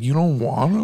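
mock sad question with a brave front; trying to be brave. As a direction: lightly imitate someone hiding disappointment. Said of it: "Like, trying to be brave".

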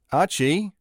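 calling a dog's name, rising. A narrator's reading. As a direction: call out into the dark cave. Calling dog in the dark.

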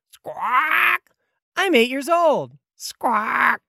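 high-pitched squawking bird-creature cry; screaming Kenku. Acted. Kenku screams back at the diving Red Rhino, squawking. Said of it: "The Kenku screams back".

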